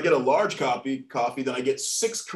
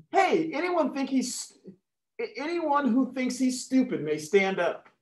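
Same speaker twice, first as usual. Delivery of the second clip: high-pitched teacher addressing the class; 9 semitones higher than usual. Acted. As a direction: challenge the class in a raised pitch. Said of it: "Teacher speaking to her class".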